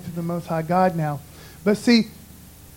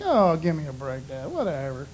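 dismissive; 6 semitones lower, 7 dB quieter than usual. Retold or imitated. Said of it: "And I was like".

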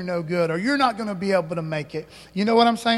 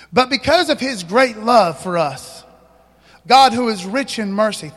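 scripture read aloud; 2 semitones higher, 7 dB louder than usual. Acted. Reading scripture from the monitor.

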